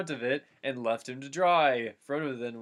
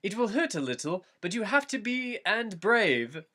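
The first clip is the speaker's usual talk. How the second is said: higher, gently coaxing Frodo; Frodo voiced; 8 semitones higher than usual. A narrator's reading. Frodo soothing hurt Merry, lightly voiced higher. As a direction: coax him like a nervous nurse. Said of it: "Frodo told him".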